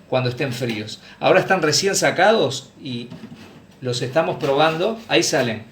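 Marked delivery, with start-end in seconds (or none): none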